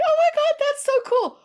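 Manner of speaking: very high, loud squeal of excitement; freaking out. Retold or imitated. Girl getting the invitation, high excited squeal. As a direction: squeal like a thrilled teen. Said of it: "she starts freaking out like".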